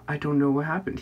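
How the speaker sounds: sudden loud imitated cry; mock outburst. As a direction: burst out loudly, a bit high. Suddenly loud acted quote.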